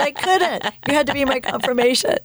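high, pleading self-defence; retold protest. Wife explains her silence to husband, high animated protest.

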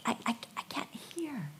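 strained hush. Acted. Elijah in the cave, softly stammering he cannot hear.

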